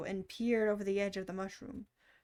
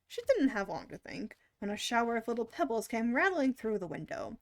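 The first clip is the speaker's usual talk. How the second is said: ordinary delivery, nothing marked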